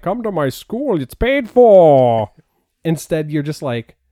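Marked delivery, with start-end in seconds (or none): 0.0-2.3 slow, grand, commanding headmaster voice; Patrick Stewart impression. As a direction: roll it out like a stately professor recruiting. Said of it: "Patrick Stewart rolling up going".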